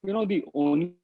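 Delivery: choppy cutting-out call audio; breaking up. Real, not acted. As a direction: voice dropping out on a bad line. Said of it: "I think you're breaking up".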